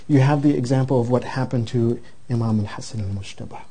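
a preacher leaning into the lesson; solemn preaching. Acted. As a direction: drive home the moral, measured and weighty. Preacher's closing exhortation.

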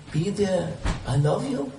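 normal talking voice

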